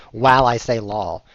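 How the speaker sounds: talking through a real laugh. Real, not acted. Streamer joking to Twitch viewers about lol, laughing. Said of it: "Look, I'm lolling".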